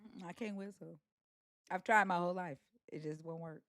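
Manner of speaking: soft, resigned admission; quiet. Real, not acted. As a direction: let it fade out quietly.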